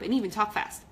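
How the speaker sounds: ordinary delivery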